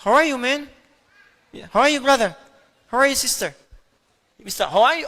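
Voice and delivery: tossed-off English "How are you, man"; English phrase. Shown as a demonstration. Teacher jokingly shows English sounds to the class, lightly.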